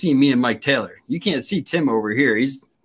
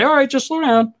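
lenient cop; 12 semitones higher than usual. Retold or imitated. Retold cop lets him off.

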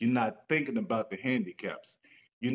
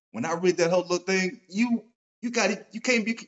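can't contain the excitement; rising excited rush of words; getting excited; 7 semitones higher, 4 dB louder than usual. Real, not acted. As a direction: pitch jumps way up, louder and faster. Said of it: "I'm getting too damn excited".

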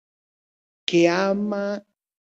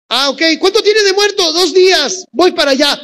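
high, loud casual Jesus; breezy Jesus voice; 9 semitones higher, 8 dB louder than usual. Retold or imitated. Jesus casually asking the messengers about Lázaro, high and loud. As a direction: toss it off, unbothered and chatty.

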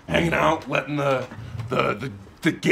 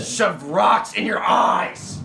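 shouted threat; 4 semitones higher, much slower than usual. Acted. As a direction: bellow it slowly.

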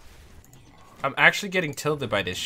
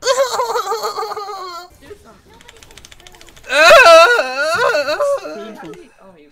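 cute anime streamer laugh; 8 dB louder than usual. Real, not acted. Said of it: "now I sound like a fucking anime girl".

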